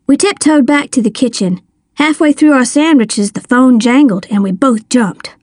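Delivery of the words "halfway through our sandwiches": plain reading voice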